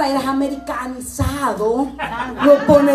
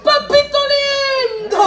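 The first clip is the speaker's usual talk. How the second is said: hammed-up wailing; 10 semitones higher than usual. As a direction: wail it like pulling your hair out. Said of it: "gritaba y te jalabas los pelos".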